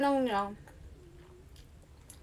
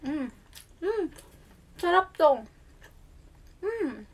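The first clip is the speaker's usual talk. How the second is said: delighted savoring; 5 semitones higher than usual. Real, not acted. Tasting pizza, savoring.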